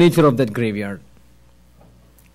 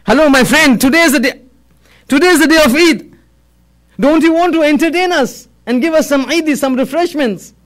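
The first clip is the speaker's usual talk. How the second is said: raised, teasing call to a friend; mildly joking; 6 semitones higher than usual. A narrator's reading. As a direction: call out in mock politeness, pitched high.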